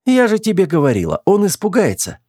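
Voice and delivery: high-pitched, amused I-told-you-so; смеясь. A narrator's reading. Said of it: "засмеялась Руди". (весело, насмешливо, высоким голосом)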